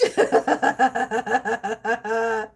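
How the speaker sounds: long giggling laugh; can't stop laughing; real giggle; laughing fit. Real, not acted. Laughing at punchline.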